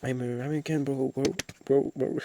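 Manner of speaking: hammed-up English-speaking accent; put-on English accent. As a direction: play a loud American bro. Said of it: "je commence à prendre mon accent anglais".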